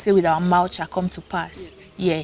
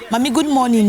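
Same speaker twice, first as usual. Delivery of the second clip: raised, sing-out morning greeting; 5 semitones higher, 4 dB louder than usual. Retold or imitated. Schoolgirl greets her aged landlady, raised greeting. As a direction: call out a polite good morning. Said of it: "I greeted her".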